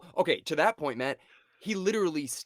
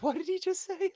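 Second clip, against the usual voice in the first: much higher, mock-startled question; high-pitched pretend double take; imagined startled reaction. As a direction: imitate someone taken aback. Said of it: "she must be like".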